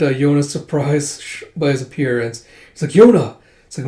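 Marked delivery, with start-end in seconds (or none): 2.9-3.4 high, loud cry of a name; urgent call. Acted. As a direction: call out to a found friend. Calls out on finding her.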